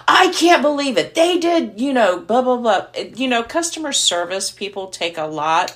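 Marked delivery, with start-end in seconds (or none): 0.1-2.9 higher, louder pretend complaint; mock angry caller. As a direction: ham up an irate customer ranting. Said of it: "if I would have been really angry".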